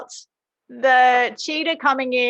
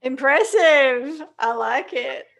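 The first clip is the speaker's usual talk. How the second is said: French accent; 3 dB louder, slower than usual. Shown as a demonstration. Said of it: "if you say it in a French accent".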